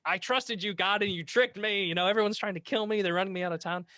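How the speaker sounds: complaining Jeremiah. Retold or imitated. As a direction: wail at God like a betrayed friend. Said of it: "Jeremiah's like".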